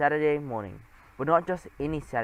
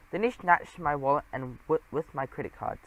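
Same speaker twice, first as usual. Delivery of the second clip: usual speaking voice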